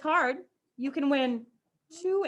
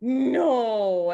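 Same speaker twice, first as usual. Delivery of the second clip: lightly voiced grandma sympathy; a gentle drawn no, no; imitating grandma; 3 dB louder than usual. Teacher imitates grandma cutting in with a lightly voiced no. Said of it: "And she'll be like".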